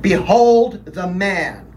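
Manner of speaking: slow, loud, high announcement; solemn proclamation. Acted. Governor pointing Jesus out to the crowd, slow loud proclamation. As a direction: present him to the crowd, slowly.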